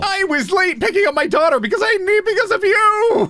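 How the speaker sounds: high, fast, indignant whine; hammed-up, high-pitched accusation; mock irate customer. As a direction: blame them in a rushed high pitch. Acts irate buyer blaming seller.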